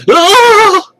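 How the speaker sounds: piercing terrified yell; frantic scream. A narrator's reading. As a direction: shriek in sheer frantic horror. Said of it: "he yells quite frantically".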